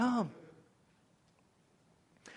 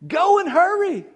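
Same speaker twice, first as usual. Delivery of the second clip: much higher, loud rallying cry; preached charge; 11 semitones higher, 6 dB louder than usual. Real, not acted. Preacher charging his church to go: high, loud rallying cry. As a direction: fling the command over the congregation. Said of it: "So I say to you".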